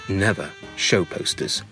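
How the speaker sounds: usual speaking voice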